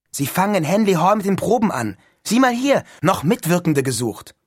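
high, loud, eager schoolboy; excited Neil. A narrator's reading. Neil shows his roommate the audition notice, excited. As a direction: burst out with the great news.

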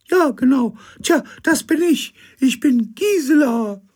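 very high, loud falsetto; Gisela's high voice. Acted. Figure at the door greets the captain, very high. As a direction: chirp it in a shrill lady voice. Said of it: "mit hoher Stimme".

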